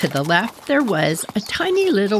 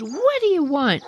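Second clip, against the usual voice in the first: irritated demand; 7 semitones higher than usual. Retold or imitated. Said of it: "she slams open her door".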